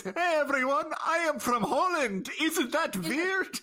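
high-pitched mock Dutch accent, last words drawn out; fake Dutch accent. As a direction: quote the line in a silly high Dutch voice. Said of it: "in his fake Dutch accent".

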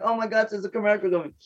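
playful fluster, fast and high; mock panic. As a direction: imitate a flustered rush, half laughing. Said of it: "I'm like".

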